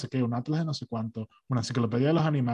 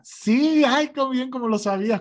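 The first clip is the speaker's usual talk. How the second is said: much higher, louder, sing-song fake praise; over-the-top patronising delight; gushing saleswoman; 12 semitones higher, 4 dB louder, slower than usual. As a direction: squeal like a cheesy saleswoman. Salesgirl praises correct answer.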